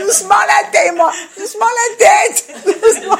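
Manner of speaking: put-on high, loud cry with a laugh, protecting the baby's head; alarmed shout. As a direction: call out in a laughing panic. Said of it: "le gosse qui bouge". (crie en riant, paniquée pour la tête du bébé)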